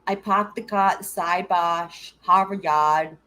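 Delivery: slow, drawn-out Boston vowels with no r's; mock Boston accent. Streamer mimicking the requested Boston accent, drawn out. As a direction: stretch it out like a hammed-up Bostonian. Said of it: "did you not hear him do that".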